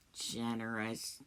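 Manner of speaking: low, slow, soft disbelief; dismissive scoff. Acted. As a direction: mull the word, low and scornful. Scoffs at being called generous.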